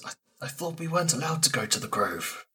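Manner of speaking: soft quick aside; mock whisper aside. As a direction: hiss it as a hushed aside.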